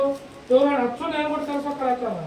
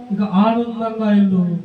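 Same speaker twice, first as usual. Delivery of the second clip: low, drawn-out blessing; solemn benediction; 7 semitones lower than usual. Acted. Preacher blessing those longing for children, solemn and low. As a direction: lower the voice and pronounce it over them. Pronounces blessing over congregation.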